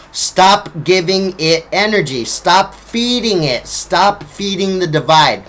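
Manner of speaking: usual speaking voice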